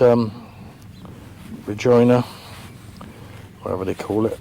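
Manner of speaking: usual speaking voice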